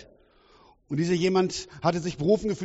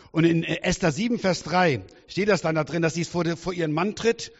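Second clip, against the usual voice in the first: usual speaking voice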